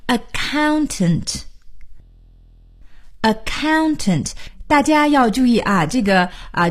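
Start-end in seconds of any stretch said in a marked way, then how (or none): 0.1-4.3 accountant said very slowly, syllable by syllable; slowed-down teaching. Shown as a demonstration. Teacher helping listeners learn a harder word slowly, slow and careful. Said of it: "我们慢慢学".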